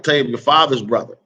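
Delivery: ordinary delivery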